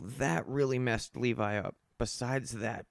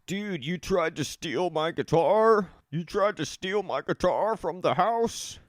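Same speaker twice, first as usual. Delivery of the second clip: angry friend; 5 semitones higher, 4 dB louder than usual. Retold or imitated. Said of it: "I get a text from Levi".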